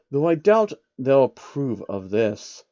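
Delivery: slow, lightly voiced grumble; a touch of frowning doubt; frowning George. George eyeing his suit, mild frown.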